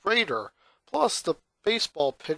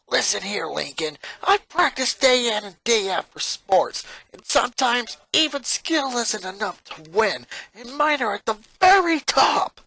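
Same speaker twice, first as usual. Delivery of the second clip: louder, higher, forceful scolding; stern lecture; 7 semitones higher, 4 dB louder than usual. Acted. Lynn points a bat at Lincoln, stern forceful lecture. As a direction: lay down the law, jabbing each point.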